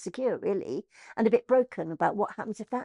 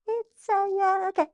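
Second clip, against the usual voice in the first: sheepish; 11 semitones higher than usual. Retold or imitated. Sheepish ask to Julian.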